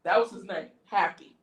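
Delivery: ordinary talk, nothing marked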